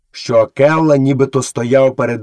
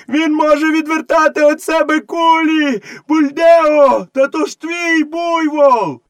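high, straining mob yell; villagers shouting; 3 dB louder, slower than usual. A narrator's reading. Villagers warning Buldeo about the bullets, shouting. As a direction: call out in high-pitched alarm. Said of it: "закричали селяни".

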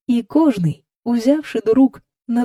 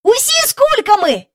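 high, loud amazed cry; boy shouting; 5 dB louder than usual. A narrator's reading. Michael spots a flying crowd, shouting excitedly. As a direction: call it out in a high child's voice. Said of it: "закричав він".

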